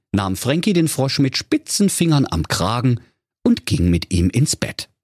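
usual speaking voice

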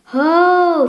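loud high call to horse. Acted. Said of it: "versuchte Maurice, sein Pferd zu beruhigen". (lauter Ruf zum Pferd)

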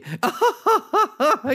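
shrill nervous forced laugh; forced high laugh. Retold or imitated. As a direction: cackle high and strained. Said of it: "Und dann so".

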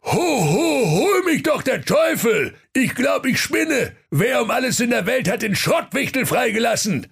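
hammed-up furious, high-pitched bellow; Weihnachtsmann. A narrator's reading. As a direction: holler it high in indignation. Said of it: "Der Weihnachtsmann sprach".

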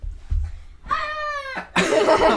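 long, high-pitched laughing fit; high laughter. Real, not acted. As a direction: dissolve into laughter.

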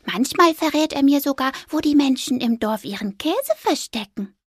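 high squeaky defending voice; reassuring little mouse. Acted. Mouse defending the ghost to the owl, high and squeaky. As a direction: vouch for your friend brightly.

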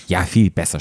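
usual speaking voice

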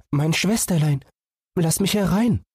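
small animal voice, longing to run out; eager little fawn. Acted. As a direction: beg like a restless young deer. Fawn calls at door.

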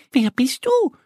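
high little duck voice, curious; Annie asking. Acted. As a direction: ask like a wondering duckling. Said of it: "fragt Annie das Männchen".

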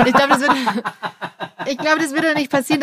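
laughing while talking. Real, not acted. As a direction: let the laugh run through the words. Laughing at fear of horror.

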